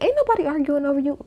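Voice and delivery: high, exasperated dismissal, voice jumps up; mock indignant retort. Cast mates to Eddie, a high indignant scoff. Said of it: "all three of them was like".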